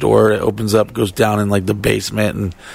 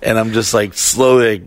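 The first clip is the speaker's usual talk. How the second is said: ordinary talk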